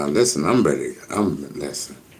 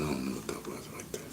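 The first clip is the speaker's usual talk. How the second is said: fast whispered aside, much softer; 5 semitones lower, 17 dB quieter, much faster than usual. Real, not acted. Streamer whispering to himself at the screen. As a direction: whisper it quick under your breath.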